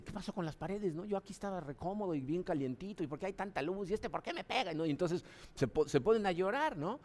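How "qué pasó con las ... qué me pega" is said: higher, softer mock baby voice; playful imitation of a confused baby; bewildered newborn. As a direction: voice a newborn baffled by light and noise. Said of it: "el bebé yo creo que sale y dice".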